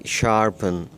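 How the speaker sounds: शार्पन with a weak uh in the last syllable, neither पन nor पेन; teacher demo of शार्पन, unstressed ending; model pronunciation. Shown as a demonstration. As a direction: say शार्पन ending in a light uhn. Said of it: "बिल्कुल हल्की सी ई की आवाज़ आएगी".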